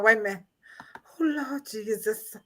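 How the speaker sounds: ordinary talk, nothing marked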